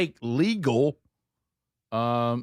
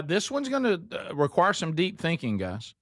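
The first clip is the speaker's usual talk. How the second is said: ordinary talk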